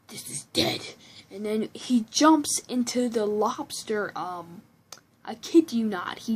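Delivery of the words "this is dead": very low monster rasp; deep, disgusted monster voice; creepy symbiote voice. Retold or imitated. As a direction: complain in a creature's growl. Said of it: "with the creepy voice".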